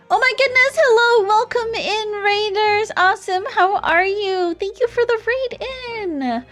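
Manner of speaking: high, bubbly raid greeting; cute anime streamer. Real, not acted. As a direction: squeal a welcome to the raiders.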